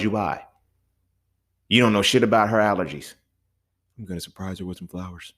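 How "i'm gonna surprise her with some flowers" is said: quiet mock voice. Acting a clueless gift giver.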